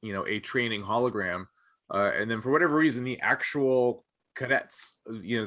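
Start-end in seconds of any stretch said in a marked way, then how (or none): none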